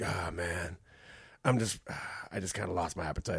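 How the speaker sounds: low, very soft mock mope; imitated deflated mumble; dejected. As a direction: sigh through it like a sulking apprentice. Imitating a dejected young apprentice.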